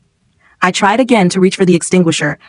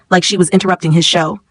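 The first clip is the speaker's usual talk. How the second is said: usual speaking voice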